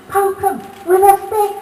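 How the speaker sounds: high, loud jeering shout; yobbo jeer. Acted. Yobbos jeering at the rabbits, high and loud. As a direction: jeer it like a rowdy lad. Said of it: "poke them rabbits with a stick".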